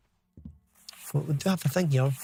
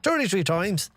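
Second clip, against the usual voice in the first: higher put-on Dublin Irish accent; 4 semitones higher than usual. Streamer protesting in a put-on voice, mock Dublin accent. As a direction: lay on a mock Dublin brogue. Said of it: "the thirty-three thing is maybe a Dublin thing".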